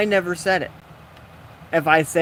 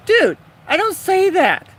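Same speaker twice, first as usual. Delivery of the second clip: much higher, louder, slow indignant protest; shocked protest; 8 dB louder, slower than usual. Retold or imitated. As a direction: deny it, scandalised and loud. Protesting the accusation in story.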